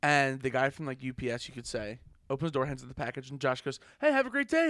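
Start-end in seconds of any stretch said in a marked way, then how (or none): 4.0-4.7 high, loud, chirpy imitated farewell; mock cheerful. Josh to the delivery guy in a retold scene, mock cheerful. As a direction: imitate an over-friendly send-off.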